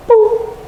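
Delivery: a tiny, very high squeaky "Boop"; playful boop sound. Retold or imitated. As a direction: pop out a cheeky high-pitched "Boop" like a spirit teasing. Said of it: "And then he goes".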